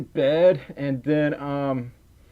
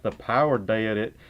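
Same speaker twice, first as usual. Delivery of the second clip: lower, thick Southern "did"; childhood Southern accent; 5 semitones lower, 3 dB quieter than usual. Shown as a demonstration. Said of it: "get rid of my Southern accent".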